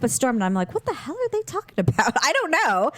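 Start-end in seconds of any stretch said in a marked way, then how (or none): 0.7-2.1 mock bafflement. Sister wonders at chattering old men, mock baffled. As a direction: wonder it in a high voice.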